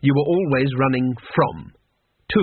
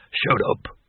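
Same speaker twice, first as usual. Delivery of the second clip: whispered shut up; a sharp whisper to silence the others; hushed. Acted. Barbarian hushing a grumbling comrade, whispered. Horde member hushing again.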